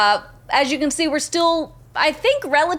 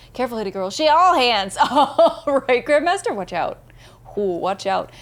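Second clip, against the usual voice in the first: ordinary talk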